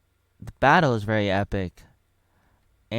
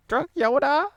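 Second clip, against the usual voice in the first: squeaky laughing; 5 dB louder than usual. Acted.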